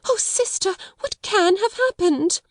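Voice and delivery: very high, plaintive wail; tearful distress. A narrator's reading. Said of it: "began to wring her fat hands and cry".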